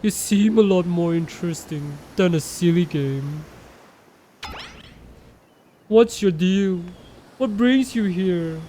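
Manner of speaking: big, brash Huntress character voice. Acted. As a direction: boom it out like a hulking hunter. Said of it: "How to do Huntress voice?".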